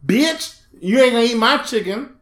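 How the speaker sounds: high-pitched comic outrage at a bird; hammed-up indignation; mock indignant scolding. Acts man scolding the seagull.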